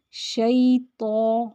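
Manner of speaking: شیطو slowly with a heavy ط. Shown as a demonstration. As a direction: stretch شیطو, thick ط. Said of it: "موٹا رکھنا ہے". (شیطو میں ط کو موٹا)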